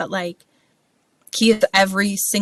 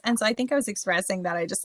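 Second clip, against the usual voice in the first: ordinary talk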